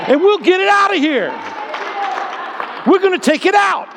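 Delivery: high, loud preaching, pitch leaping up; full-force preaching. Real, not acted. Preacher declaring to the congregation that fear is rooted out, full-force preaching. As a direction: preach it out at a shout.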